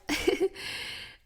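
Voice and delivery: light, high little laugh; short chuckle. Real, not acted. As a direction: chuckle briefly after your own advice. Laughs after own heartfelt advice.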